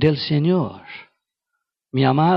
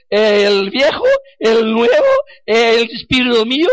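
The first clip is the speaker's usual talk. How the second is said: crying out; 7 semitones higher, 5 dB louder than usual. Acted. Preacher's inner confusion, crying out.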